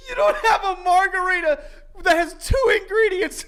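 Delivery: high-pitched, laughing disbelief; incredulous rant. Real, not acted. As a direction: rant through laughter at the absurdity. Ranting incredulously through laughter.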